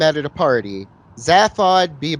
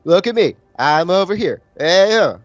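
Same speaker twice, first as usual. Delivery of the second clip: higher and louder, waving for attention; attention-seeking call; 4 semitones higher, 4 dB louder than usual. Retold or imitated. The president acting as a distraction, loud and higher. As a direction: shout look at me, higher. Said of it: "He's just like".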